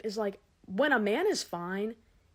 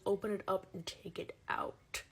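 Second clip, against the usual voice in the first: whispered clerk; 2 semitones lower, 6 dB quieter than usual. Retold or imitated. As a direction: whisper the order like a mugger. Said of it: "She hands me the letter back and goes".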